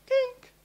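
tiny high-pitched "kink"; a quick squeak, like eyes popping open; sudden waking noise. Retold or imitated. Mimics waking suddenly at four.

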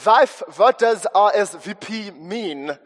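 slow, loud Eastern European husband; thick Eastern European accent. Acted. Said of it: "Eastern European accent, the husband says".

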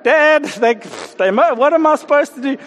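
high, stumbling put-on protest; mock exasperated son. Eldest son appeals to Dad about the kids, mock exasperation.

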